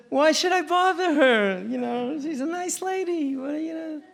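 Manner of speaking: put-on kid's voice. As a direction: play a boy shrugging it off, high and slow. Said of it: "He said".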